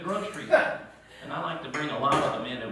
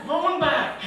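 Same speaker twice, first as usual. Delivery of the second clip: truck backing call; 14 semitones higher, much slower than usual. Retold or imitated.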